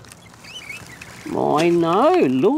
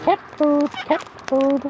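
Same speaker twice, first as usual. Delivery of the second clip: high bird call; 10 semitones higher, slower than usual. Real, not acted. Calling to the birds.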